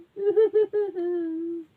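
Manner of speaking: high, pulsing fake sobs; hammed-up cub blubbering; mock boo-hoo. A narrator's reading. Little bear with no porridge, pretend sobbing.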